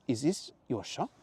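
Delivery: put-on high, slow foreign-accented young man; excited tourist in a put-on accent; Mateo's accent. Mateo voiced.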